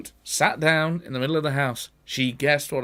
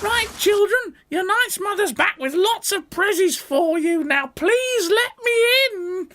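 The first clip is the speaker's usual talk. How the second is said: high sugary put-on mum voice; wolf sweet-talking through the window; wolf faking mother. Wolf coaxing the kids to let him in, high fake mum voice.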